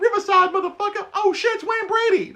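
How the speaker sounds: high, loud comedy yell; hammed-up Chappelle yell; yelling sketch quote. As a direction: shout it out like a running gag. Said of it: "I'll yell out".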